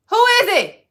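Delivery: high, loud call through the door. Real, not acted. Calling out to the door.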